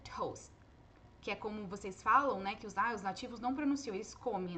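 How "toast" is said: "toast" soft, final t held without release; just close off the t in "toast"; unreleased T. Shown as a demonstration. Teacher showing the stop without release, softly. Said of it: "a gente só faz a parada".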